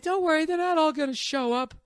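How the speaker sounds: very high, airy-headed mock woman's voice; imitating partner. Partner brushing off his worry, mimicked in falsetto. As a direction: mimic her carefree reassurance way up high. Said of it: "She's like".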